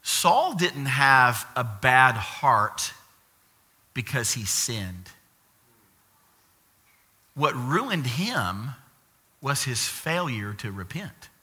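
measured, spaced-out emphasis; deliberately slow. Real, not acted. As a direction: spell it out slowly because it matters. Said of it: "I'm gonna say it slowly".

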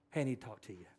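very soft, low, tender voice; hushed, caring and low; gentle, loving, kind. Shown as a demonstration. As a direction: approach them softly and kindly. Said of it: "say with a gentle voice, loving, kind".

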